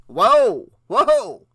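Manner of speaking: loud, startled shout; startled man's outburst. A narrator's reading. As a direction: shout in alarm, high and loud. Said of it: "shouted Ned".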